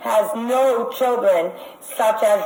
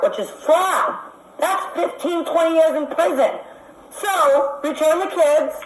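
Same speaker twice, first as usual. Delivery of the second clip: higher-pitched, rising heat as she warns them; heated accusation; 7 semitones higher than usual. Real, not acted. Caller warning the agency secretary, heated and higher-pitched. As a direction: press the threat home, getting worked up.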